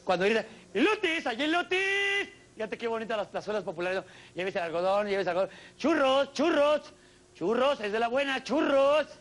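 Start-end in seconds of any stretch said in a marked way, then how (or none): 0.7-2.3 high drawn-out corn seller's call; mock vendor calling out elotes; street vendor cry. 5.8-9.1 vendor call. Retold or imitated. Street vendor cry.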